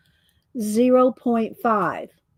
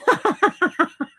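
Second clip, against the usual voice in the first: delighted laugh; 6 semitones higher than usual. Real, not acted.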